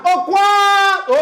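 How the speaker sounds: high, slow call stretching "qua" in "quadro"; calling out the word. Shown as a demonstration. Teacher showing the class the tonic syllable of quadro, calling out. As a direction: holler it, holding the first syllable. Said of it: "Agora grite ela".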